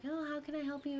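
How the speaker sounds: high, sweet phone voice; imitated helpful manager. Manager answering the phone to a customer, helpful.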